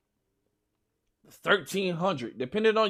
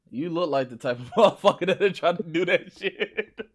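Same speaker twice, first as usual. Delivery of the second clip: laughing tease; 2 semitones lower than usual. Real, not acted. Friend teasing a speedrunner, laughing. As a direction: laugh breaking into the words.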